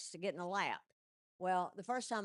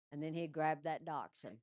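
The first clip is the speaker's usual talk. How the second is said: usual speaking voice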